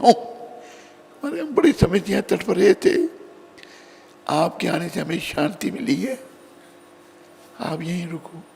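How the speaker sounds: high, thin-voiced plea of the suffering; pleading souls. Suffering souls begging King Janak to stay, pleading high. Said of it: "वो चिल्लाए".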